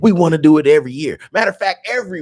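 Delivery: loud, high, impassioned demand; real fired-up outburst; fired-up insistence. Real, not acted. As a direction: push the point hard and high. Arguing for yearly Omaha Days.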